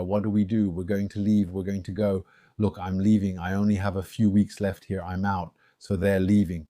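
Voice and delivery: ordinary talk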